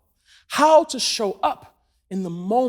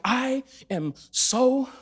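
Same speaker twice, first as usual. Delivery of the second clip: mock exhaustion; 5 dB quieter, much slower than usual. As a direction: drag it out like a worn-out girlfriend. Acts weary girlfriend, drawn out.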